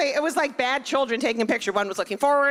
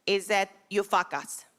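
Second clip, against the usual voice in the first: put-on Israeli accent; 5 semitones higher, 3 dB quieter than usual. Imitating her Kabbalah teacher with an Israeli accent. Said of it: "crazy Israeli accent".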